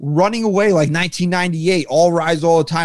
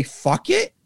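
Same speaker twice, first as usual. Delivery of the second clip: imagined shrug; 13 semitones higher, 5 dB quieter than usual. Retold or imitated. Imagined manager giving up.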